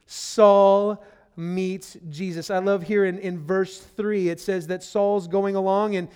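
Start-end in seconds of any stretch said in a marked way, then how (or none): 0.1-2.5 Saul meets Jesus, slow and weighty, each word landed; slow it right down and lean on every word; slow emphatic. Acted. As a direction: proclaim it slowly and firmly. Repeated slowly, louder.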